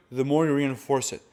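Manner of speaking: normal talking voice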